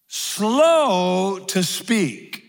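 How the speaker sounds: very slow, each word stretched out; drawn-out slow. Shown as a demonstration. Preacher to congregation, slow to speak drawn out very slowly. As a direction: stretch it like you mean slow.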